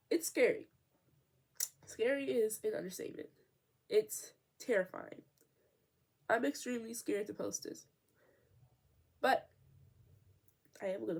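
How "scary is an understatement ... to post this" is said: usual speaking voice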